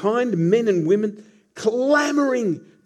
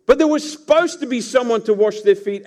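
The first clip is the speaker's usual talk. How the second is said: ordinary delivery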